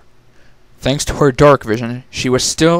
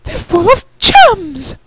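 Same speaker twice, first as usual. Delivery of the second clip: much higher, louder, slow delighted squeal; 6 dB louder, slower than usual. Acted. Rarity seeing the gem walls, a high delighted squeal. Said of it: "she squealed".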